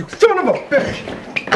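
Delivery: high, slow, drawn-out curse; explode, stretching out every word; furious outburst. Acted. Blowing up at his partner's reminder, furious outburst. Outburst at mention of old camp-out.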